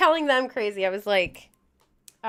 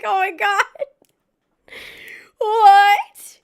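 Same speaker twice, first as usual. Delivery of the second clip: higher, louder "What?" in playful disbelief; mock shock; 9 semitones higher, 8 dB louder, much slower than usual. Mom retelling her shock at her workout clothes, with hammed-up surprise. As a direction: gasp it out like you can't believe your eyes. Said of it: "I'm like".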